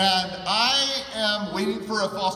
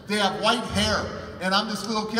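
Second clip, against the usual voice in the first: usual speaking voice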